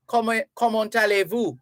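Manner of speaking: "Comment, comment allez-vous?" said the French way, comment with silent final t, allez as a-lay; French phrase. Shown as a demonstration. Host teaching listeners a French greeting, careful French. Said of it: "because in French".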